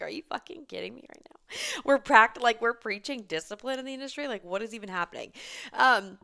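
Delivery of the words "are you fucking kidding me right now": hushed, breathless whisper of disbelief; an incredulous whisper to herself. Retold or imitated. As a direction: whisper it like you can't believe it. Retelling her reaction to definition.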